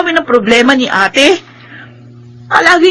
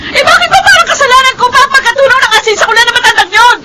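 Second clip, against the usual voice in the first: defensive outburst; 5 semitones higher than usual. Acted. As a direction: throw the blame back, shouting. Sister's quarrel, defensive. (pasigaw na pagtatanggol sa sarili)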